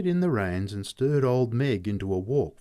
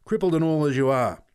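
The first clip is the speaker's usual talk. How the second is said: louder, higher angry threat; 5 semitones higher, 4 dB louder than usual. Acted. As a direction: silence the boy. Pat threatening the boy.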